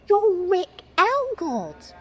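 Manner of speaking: high, slow, drawn-out disbelief, re-enacted; starstruck nutter. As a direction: act out stunned recognition of a long-sought idol. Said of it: "like I'm a complete nutter".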